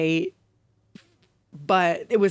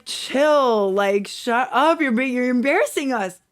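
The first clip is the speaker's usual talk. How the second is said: louder, higher mock scold; annoyed scolding; 5 semitones higher, 6 dB louder than usual. Ben telling the roommate to shut up, mock scolding. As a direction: snap at him to cut it out. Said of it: "And Ben's like".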